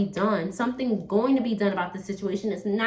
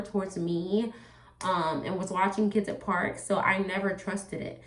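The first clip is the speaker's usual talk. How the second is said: ordinary delivery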